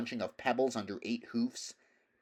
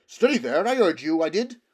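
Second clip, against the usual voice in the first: loud, higher, pressing horse voice; insistent horse; 8 semitones higher, 11 dB louder than usual. Acted. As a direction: press the mare to admit it. Bree confronting the mare.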